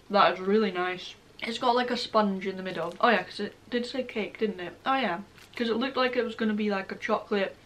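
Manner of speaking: chewing while talking; speaking around food; mouth full. Real, not acted. Seen 1: Bites bar at -1.0; lips pressed, chewing while speaking after.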